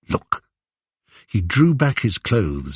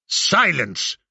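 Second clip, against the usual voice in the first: sharp, commanding bark, pitch raised; barked order; 6 semitones higher than usual. Acted. Antinous silencing the tittering crowd, barked command. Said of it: "barked Antinous".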